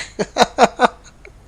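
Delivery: bright laugh. Real, not acted. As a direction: laugh out at the joke. Laughing at joke.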